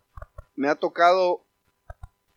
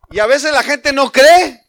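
preaching shout; 6 semitones higher, 15 dB louder, slower than usual. Real, not acted. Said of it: "preacher raises voice".

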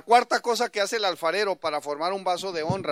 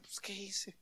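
very soft, to himself; hushed self-questioning; puzzled inner thought; 2 semitones higher, 7 dB quieter, slower than usual. Retold or imitated. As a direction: wonder privately what went wrong. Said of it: "yo empezaba a pensar".

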